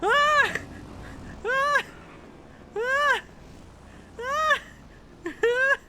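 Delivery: sharp frightened yelps; screams of fright. Real, not acted. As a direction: scream in panic. Screaming at ghost car.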